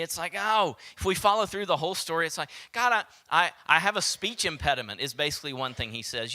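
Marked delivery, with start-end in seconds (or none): 0.4-5.0 high and loud, tumbling protest; panicked objecting. Acted. Moses protesting to God, high and panicked. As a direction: sound like a man backing out fast.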